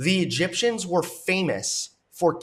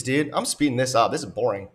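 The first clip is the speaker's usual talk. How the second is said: ordinary talk, nothing marked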